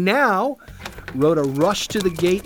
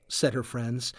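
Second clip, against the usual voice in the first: ordinary delivery, nothing marked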